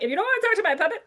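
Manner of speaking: fast, high, loud teasing with laughter; teasing vetting. Retold or imitated. Teacher teasing adults who won't talk to her puppet, high laughing tease. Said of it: "You're like".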